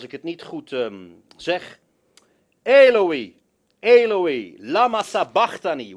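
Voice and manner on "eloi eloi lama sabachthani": a raised anguished call; loud cry. Acted. Preacher reading the verse, crying out the Aramaic.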